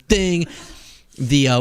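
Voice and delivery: ordinary talk, nothing marked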